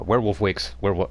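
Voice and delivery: usual speaking voice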